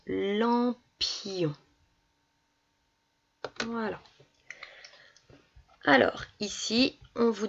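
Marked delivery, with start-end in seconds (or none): 0.1-1.5 "lampion" very slowly, syllable by syllable, i held long; drawn-out syllables. Shown as a demonstration. Teacher giving an example word for ion, drawn out. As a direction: stretch "lampion" apart, long i before on. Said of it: "comme dans".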